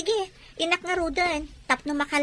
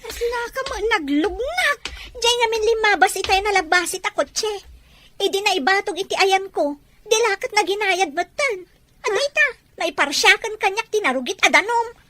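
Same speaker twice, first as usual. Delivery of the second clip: louder, higher indignant rant; upset complaint; 6 semitones higher, 5 dB louder than usual. Acted. Girl tells friend about the driver, indignant. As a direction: vent about the rude driver.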